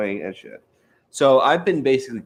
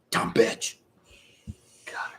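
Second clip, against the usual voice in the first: whispered insult; 4 dB quieter, slower than usual. Real, not acted. Whispered insult after retold rant.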